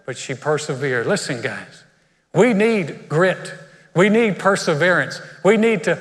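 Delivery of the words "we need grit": slow, weighty stress on grit; slow emphasis. Real, not acted. Preacher urging the church, slow and emphatic. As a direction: drive home each word. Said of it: "emphatic sermon point".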